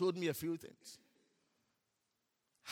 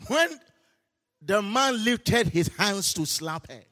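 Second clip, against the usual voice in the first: slow, louder, drawn-out storytelling; dramatic slow preaching; 7 semitones higher, 10 dB louder, slower than usual. Acted. Preacher retelling the angel story to the church, slow dramatic build. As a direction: build the miracle moment slowly.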